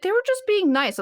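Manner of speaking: high-pitched mock innocence; naive protest. Friend denying someone flirted with her, mock-innocent. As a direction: imitate an oblivious friend brushing it off.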